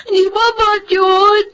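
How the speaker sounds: high, tearful outburst; crying protest. Acted. Girl sobbing to her nanny. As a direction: cry out the question. Child upset about father.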